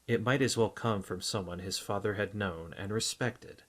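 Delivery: ordinary delivery, nothing marked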